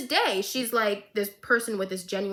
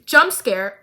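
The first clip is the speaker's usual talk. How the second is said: normal talking voice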